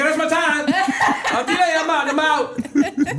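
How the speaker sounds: very high, loud getting out fast; high hasty exit. Retold or imitated. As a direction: squeak it while bolting. Fleeing the stage.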